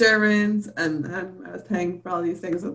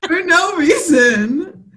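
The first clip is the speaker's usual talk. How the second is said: laughing protest; 10 semitones higher, 4 dB louder, much slower than usual. Real, not acted. Laughing mock complaint at friend.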